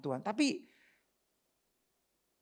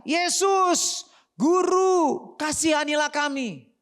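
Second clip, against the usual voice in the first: loud, high cry for mercy; pleading shout; 8 semitones higher, 7 dB louder, much slower than usual. Acted. Lepers calling to Jesus from far off, pleading shout. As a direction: call out at full voice, stretching the words. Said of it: "Mereka berteriak".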